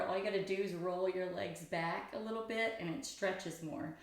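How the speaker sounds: ordinary delivery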